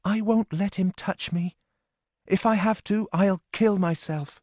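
put-on frightened young Lorna. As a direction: voice her like a scared young woman pleading.

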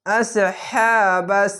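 আসহাবাস with a thick squeezed ain, slowly chanted; heavy throat letter. Shown as a demonstration. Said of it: "এক আলিফ টেনে পড়বেন".

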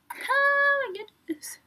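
high, drawn-out fangirl squeal; gushing. Real, not acted. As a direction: gush in a high, stretched-out voice. Gushing over photos of favorite members.

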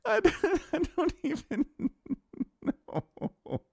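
words squeezed out between real laughs; laughing too hard to finish the sentence; breathless giggling disbelief; helpless laughter. Real, not acted. Caster can't stop laughing.

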